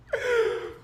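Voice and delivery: a short, high squeal of laughter. Real, not acted. Still laughing at chat.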